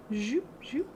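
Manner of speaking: playful whispered brush noises; whispered zoop. Pretend. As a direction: whisper the strokes like sound effects. Sound effect while brushing.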